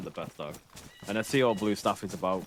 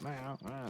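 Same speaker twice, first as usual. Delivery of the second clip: soft, higher-pitched meowing; imitated cat meow; 5 semitones higher, 11 dB quieter than usual. Owner mimicking his late cat, soft high meows. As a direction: mew quietly like a cat. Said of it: "the cat's there, and he's like".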